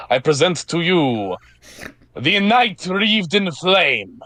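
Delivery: put-on grand Russian-accented herald, stretching the last words slowly; corrected Russian accent. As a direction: announce the flame knight in a proper Russian accent, slow at the end. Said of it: "do it right, do it right".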